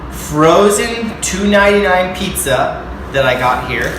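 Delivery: loud, punchy TV-host intro, slow at the start; hyped vlog intro. Acted. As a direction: sell it like a cooking show host.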